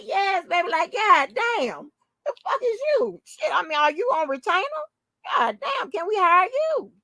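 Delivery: high-pitched excited yelling; excited hollering. Retold or imitated. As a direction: holler it like a thrilled fan. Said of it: "I'm hollering".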